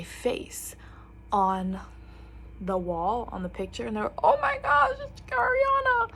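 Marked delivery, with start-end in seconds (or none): 4.2-6.1 much higher, louder squeal; mock fanboy squeal; excited boys. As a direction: gush like starstruck boys. Boys spotting her photo.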